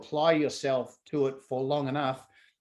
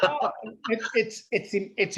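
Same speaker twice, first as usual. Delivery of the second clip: higher, laughing, then rushing on; laughing answer; 7 semitones higher than usual. Real, not acted. Guest asked why it matters, laughing and eager.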